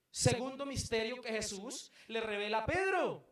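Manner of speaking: fiery preaching. Acted. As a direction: proclaim it to the whole church. Preacher rising to full force. (predicación ardiente a voz en cuello)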